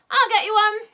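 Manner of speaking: put-on a keen old man rushing off; eager old Saucepan.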